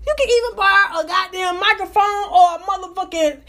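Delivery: sassy incredulous tirade at the neighbor; retold rant. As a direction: build the outrage to a shout at the end.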